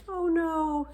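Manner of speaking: high, loud dismay; Louis dismayed. A narrator's reading. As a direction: groan at the bad news. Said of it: "said Louis".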